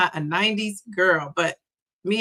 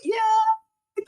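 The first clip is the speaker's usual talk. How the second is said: a high squeal of yay; excited yay; 12 semitones higher than usual. Real, not acted. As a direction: cheer her arrival. Welcoming a new viewer.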